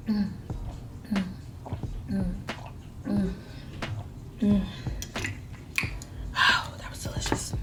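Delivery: breathy whisper between sips; whispered tasting. Real, not acted. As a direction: whisper your verdict on the drink. Tasting a drink on camera.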